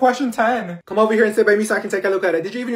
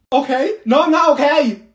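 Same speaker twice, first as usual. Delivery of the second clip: loud, high, offended retort; indignant outburst; 7 semitones higher, 6 dB louder than usual. Acted. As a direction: snap back loudly, deeply offended. Upset girl snaps at friends.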